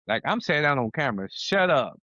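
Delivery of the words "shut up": higher, punchy dismissal; emphatic telling-off. Real, not acted. Father to unhelpful relatives on camera, emphatic telling-off. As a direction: tell them off in two punched words.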